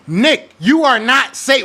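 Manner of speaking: slow, weighty warning to the coach; Nick, you are not safe, each word weighed; stern warning. Real, not acted. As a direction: spell it out slowly, a threat.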